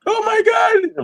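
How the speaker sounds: very high mock fan squeal; uncontained fan reaction. Host imagines guest meeting her idols, uncontained excitement. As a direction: gush like a fan losing control. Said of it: "Would you be able to, like, contain yourself".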